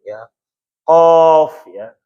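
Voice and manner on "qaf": "Qaf" stretched out long, louder; elongated recitation. Shown as a demonstration. Teacher showing students the six-harokat reading, "Qaf" held long. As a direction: hold "Qaf" on one long, steady drawn-out vowel. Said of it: "dibacanya enam harokat".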